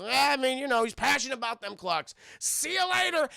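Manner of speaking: a hint of breezy drunk; drunk old friend. Drunk friend signing off to Lara, lightly tipsy voice. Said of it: "it's the drunk, uh, friend of her father".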